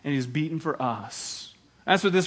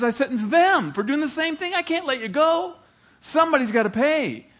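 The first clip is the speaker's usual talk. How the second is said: much higher, loud insistence; emphatic shout; 9 semitones higher, 4 dB louder than usual. Acted. Judge insisting to the defendant that somebody must pay. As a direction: hammer the refusal home.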